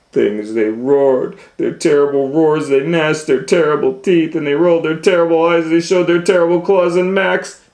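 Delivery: high, loud, rushing storybook voice; frantic monster climax. A narrator's reading.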